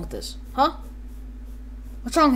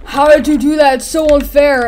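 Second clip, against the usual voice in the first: loud, high whiny protest; hammed-up outraged game character; mock indignation; 5 semitones higher, 8 dB louder than usual. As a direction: complain like a sore loser. Voices beaten character protesting.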